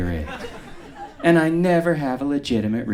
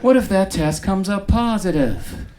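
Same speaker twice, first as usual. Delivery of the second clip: higher, eager buddy voice, hammed-up; mock pal; 7 semitones higher than usual. Asking the dermatologist about the test, mock-chummy and higher. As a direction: chum it up with the doctor. Calling the doctor.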